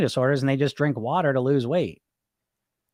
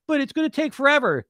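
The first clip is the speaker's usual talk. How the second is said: whiny bully; 11 semitones higher than usual. Acted. Bully voice whines back.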